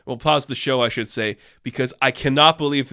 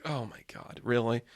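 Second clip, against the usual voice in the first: deadpan muttered disbelief; 3 semitones lower, 11 dB quieter than usual. Retold or imitated. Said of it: "I'm like".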